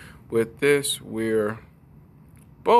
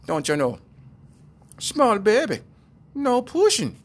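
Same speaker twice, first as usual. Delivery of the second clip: high, loud put-on voice; short phrases pitched up high, hammed-up; mock know-it-all reply; 11 semitones higher, 5 dB louder than usual. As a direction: explain it in a silly high character voice. Acted argument continues in story.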